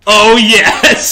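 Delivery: high, loud fanboy cheer; retold delighted cheer. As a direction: re-enact your buddies' thrilled outburst. Retells buddies' delighted movie reaction.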